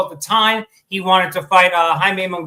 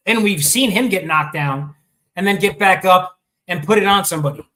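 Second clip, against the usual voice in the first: ordinary delivery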